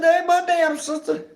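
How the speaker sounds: high and loud, blurted; high loud outburst. Retold or imitated. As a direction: blurt it out loudly. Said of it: "I said".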